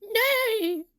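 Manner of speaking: high, drawn-out theatrical refusal; mock-heroic cry. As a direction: burst out like a play superhero. Boy launches into hero act.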